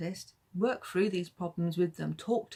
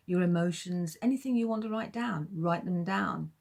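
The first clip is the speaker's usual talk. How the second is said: ordinary delivery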